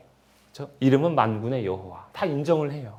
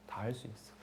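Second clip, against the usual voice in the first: soft echo; 4 semitones lower, 20 dB quieter than usual. Acted. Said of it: "이렇게 하는 거예요".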